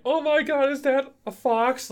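high, loud gushing wife voice; imitating excited wife. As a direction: mimic her thrilled discovery, way up high. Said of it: "she's pretty excited about it too".

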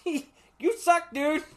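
high, laughing you suck dude; high, loud laughing jeer; laughing taunt. Retold or imitated. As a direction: tease him through laughter.

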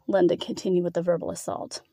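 plain reading voice

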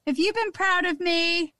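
high, hopeful little question; mock touched daughter. Daughter asking mum about her CD, high and hopeful.

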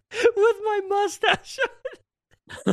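laughing hard, words stretched slow. Real, not acted. Telling his cohost about the Borat costume, laughing hard. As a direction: crack up through the line.

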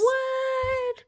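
high-pitched disbelief; stunned what; shocked. Real, not acted. Shocked she is older.

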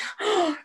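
high mock gasp of discovery; sharp gasp. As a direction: gasp in fake shock. Girl gasps in retelling.

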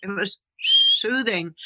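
slow, "soothing" with a long hissed s; drawn-out sibilants. Pretend. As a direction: stretch the s at the start of "soothing". Hissing voice joke demonstrated.

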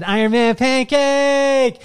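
slow, high, loud drawn-out exclamation; awed cry. Retold or imitated. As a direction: let it ring out in pure wonder. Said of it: "And he said".

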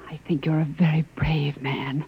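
hushed, close whisper. Acted. Wife whispers her admiration to her husband, softly. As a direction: breathe it softly, full of admiration. Tender praise after torture.

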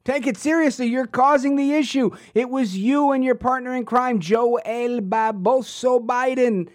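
high-pitched, incredulous indignation; indignant retort. Real, not acted. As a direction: throw the words back as an accusation. Indignant retort to the played clip.

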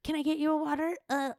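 high, timid offer; a shy twenty-year-old asking the actor; nervous intern voice. Retold or imitated. Intern nervously offering the actor water, high and timid. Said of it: "like, nervous".

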